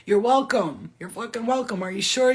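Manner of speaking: ordinary talk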